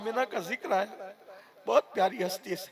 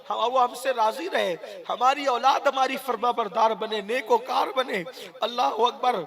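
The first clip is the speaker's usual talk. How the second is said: raised, louder supplicating prayer voice; fervent prayer; 4 semitones higher, 3 dB louder than usual. Acted. Preacher praying aloud for the congregation's parents, fervent supplication. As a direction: pour it out as a pleading prayer over the crowd.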